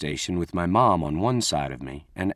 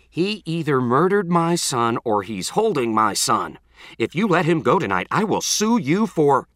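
high, loud outraged mother; furious blast; 6 semitones higher, 4 dB louder than usual. Acted. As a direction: blast it in fury. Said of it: "Missus Creed's voice blasted".

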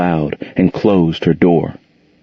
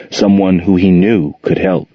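ordinary delivery, nothing marked

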